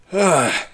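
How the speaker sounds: exasperated guard's sigh; heavy sigh. Acted. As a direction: let out a long audible sigh. Guard reacts to badge demand.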